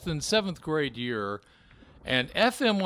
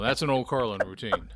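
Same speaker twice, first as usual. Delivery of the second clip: ordinary talk, nothing marked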